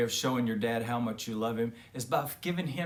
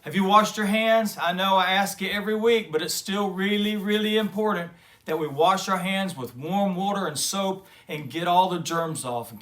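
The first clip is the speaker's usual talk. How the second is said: higher, louder reminder to little ones; upbeat kids' host; 4 semitones higher, 3 dB louder than usual. Acted. Papa Bear reminding the cubs to wash hands, upbeat and coaxing. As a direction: coax the kids along brightly.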